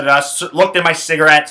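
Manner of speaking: plain reading voice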